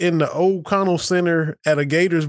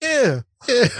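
mock Southern accent; 8 semitones higher than usual. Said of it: "he's trying to be Southern".

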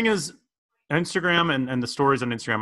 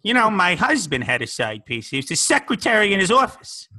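put-on louder, higher old lady gossip voice; older lady; 6 semitones higher, 6 dB louder than usual. Improvised older lady character bit.

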